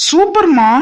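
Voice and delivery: bursts out with high, loud delight. Acted. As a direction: cheer the idea like it's brilliant. Delighted praise of the plan.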